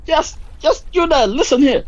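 bossy call. Acted. Ordering tribe, pitch high.

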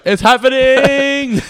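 drawn-out high yell. Pretend. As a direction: belt it out slowly. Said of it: "No, I didn't yell anything".

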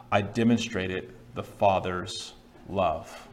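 hushed weight on the climax; solemn declaration. Acted. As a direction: let each phrase land with pauses. Climax of voiced bucket list.

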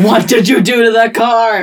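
high, loud indignant shout; hammed-up shouted accusation; mock outrage. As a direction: demand it in mock fury. Acted outraged car owner.

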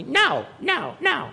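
put-on high toddler "No, no, no"; mock toddler saying no; child's refusal. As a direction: play a tiny kid refusing. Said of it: "Their very first response is gonna be".

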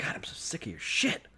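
fed-up whisper; whispered complaint. Retold or imitated. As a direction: hiss it under your breath, fed up.

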